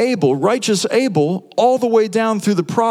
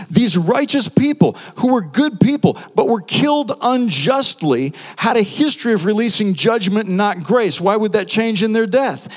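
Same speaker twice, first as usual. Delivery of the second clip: ordinary talk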